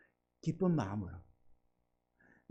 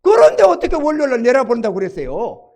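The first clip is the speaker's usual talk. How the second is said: louder, sulky grumbling protest; sulky complaint; 3 semitones higher, 7 dB louder than usual. Retold or imitated. Nephew sulkily challenging his uncle's promise. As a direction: push back with a resentful whine. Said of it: "볼멘 소리를 딱 하면서".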